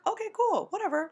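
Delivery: airy high okay cool, brushing it off; mock breezy. Retold reply to friend.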